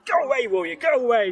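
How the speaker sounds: high, loud cry to drive off a beast; acted frantic warding-off. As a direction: shout it high, fighting the creature back. Woman fends off attacking werewolf.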